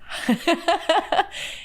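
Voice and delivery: high giggle; high run of giggles before a hard word; helpless giggling. Real, not acted. Vlogger asks forgiveness for the next one, giggling.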